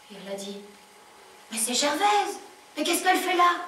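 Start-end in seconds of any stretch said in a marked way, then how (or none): 1.5-3.6 raised pitch, startled questioning; higher voice of astonished recognition; surprised concierge. Acted. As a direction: exclaim at finding someone you know. Concierge finds Gervaise outside.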